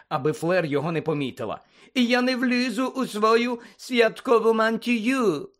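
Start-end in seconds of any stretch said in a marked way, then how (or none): 1.9-5.5 high, very slow peevish complaint; Fleur's querulous whine. A narrator's reading. Fleur fretting about her dress robes, peevish. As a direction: whine it high, dragging the words out. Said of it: "сказала вона сварливим голосом".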